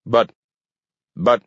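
short stammered protest with pauses; falter, gasping for words; grasping for words. A narrator's reading. Flustered mayor tries to answer Gramp, stammering. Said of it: "the mayor was grasping for words".

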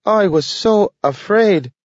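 a small eager dog, voiced high and bright-eyed; Nathaniel the dog. A narrator's reading. Dog Nathaniel relieved to find Grant awake, high dog voice. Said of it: "said Nathaniel".

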